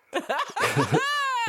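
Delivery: sudden high laugh; burst of laughter. Real, not acted. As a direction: crack up at the joke. Laughing at the clerk's line.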